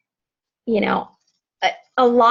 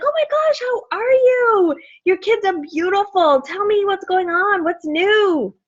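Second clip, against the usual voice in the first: much higher, excited catching-up voice; gushing greeting; 8 semitones higher than usual. Retold or imitated. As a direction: gush as if reconnecting with an old friend. Acts out delighted greetings to old friends.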